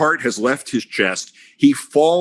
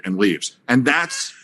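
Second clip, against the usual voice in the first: usual speaking voice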